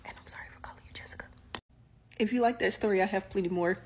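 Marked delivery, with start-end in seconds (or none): 0.1-1.3 breathy fast whisper. Pretend. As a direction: whisper it like a secret apology. Whispered apology to the ghost.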